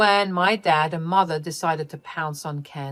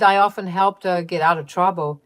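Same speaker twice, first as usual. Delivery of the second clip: usual speaking voice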